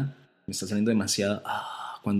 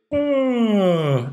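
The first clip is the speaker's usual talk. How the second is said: a big open yawn, pitched high; yawn sound; 10 semitones higher, 4 dB louder than usual. Shown as a demonstration. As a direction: let out a natural yawning ah. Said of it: "todo el mundo bosteza y dice". (bostezo sonoro y abierto)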